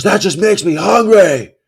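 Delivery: high mock shout of a heckler; imitated shout. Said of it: "He shouts it".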